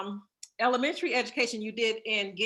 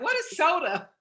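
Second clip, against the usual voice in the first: laughing mock question; 7 semitones higher, 3 dB louder than usual. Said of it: "They're like".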